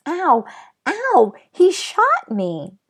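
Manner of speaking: high, loud cries of pain, slowing on the last words; screaming in pain. Acted. Patrick hit by the tiny cowboy's bullet, screaming in pain. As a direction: yelp it out like he's just been hit. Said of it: "Patrick screamed".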